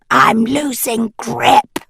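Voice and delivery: slow, strained yelp of fear; losing her hold, she yelps. Acted. Anadil slipping off an icicle, a frightened yelp. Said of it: "Anadil yelped".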